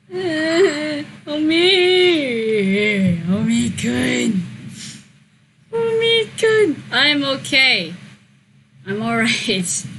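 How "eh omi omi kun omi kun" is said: a lightly voiced Otsumu calling for Omi; pretending to be Otsumu. Streamer playing Otsumu, whining at Omi. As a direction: call "Omi-kun" slowly, the last time much higher. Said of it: "me pretending to be Otsumu".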